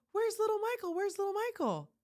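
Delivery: high, eager mock-fan voice; excited fan. Excited woman at the airport looking for the youngest, thrilled. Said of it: "He's all excited".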